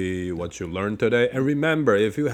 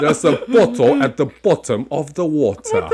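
louder British accent, bottle with a sounded t; 3 semitones higher, 6 dB louder than usual. Shown as a demonstration. Teacher repeats the phrase in a British accent. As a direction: model it in a crisp British accent. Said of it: "One more".

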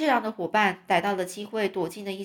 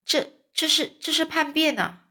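high, louder stammer of alarm; shocked; 8 semitones higher, 3 dB louder than usual. A narrator's reading. Reacting to a mutiny plan, shocked stammer. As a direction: stumble on the first words, pitched well up.

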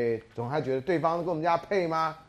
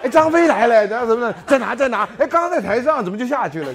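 excited crowd; 10 semitones higher, 13 dB louder than usual. Retold or imitated. Mocks crowd looking for Zhang Fei.

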